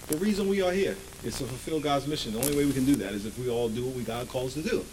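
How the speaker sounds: ordinary talk, nothing marked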